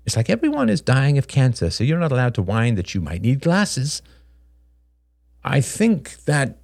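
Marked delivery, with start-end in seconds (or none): none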